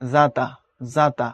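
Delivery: জাতা said "zata", with a buzzing z; soft z sound. Shown as a demonstration. Teacher showing the second pronunciation, জাতা with z. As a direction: open জাতা on z, never a pressed j. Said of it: "শুধু জ দিয়ে হয়".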